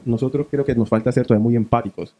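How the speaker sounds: normal talking voice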